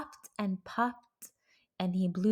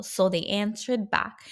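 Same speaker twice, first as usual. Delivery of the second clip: usual speaking voice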